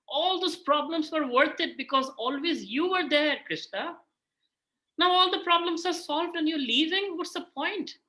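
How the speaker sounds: high, pleading protest to Krishna; acted anguished appeal; distressed Kunti Devi. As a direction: plead with rising pitch, stretching the words. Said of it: "Kunti Devi is very sad".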